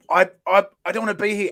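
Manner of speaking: ordinary delivery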